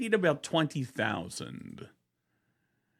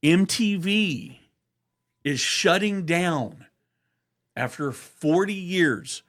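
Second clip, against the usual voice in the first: slow, louder, higher announcer read; news announcer; 5 semitones higher, 3 dB louder than usual. Pretend. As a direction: announce it like a big headline. Mock news bulletin announcement.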